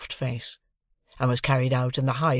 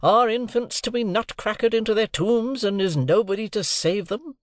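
much higher, pleading despair; husband in desperate protest; 9 semitones higher than usual. A narrator's reading. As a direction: beg the table to save the baby. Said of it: "in an outbreak of desolate desperation".

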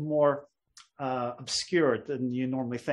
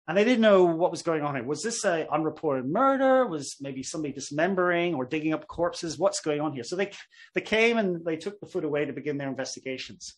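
normal talking voice

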